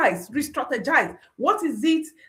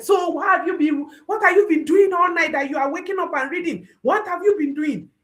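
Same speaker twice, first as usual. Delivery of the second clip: fast, raised, scolding shout; mock angry parent; 5 semitones higher, faster than usual. Parent scolding the child who failed the exam, mock shout.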